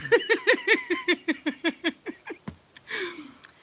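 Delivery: loud high cackling laugh; real helpless laughter. Real, not acted. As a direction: burst out cackling. Cracks up at own joke.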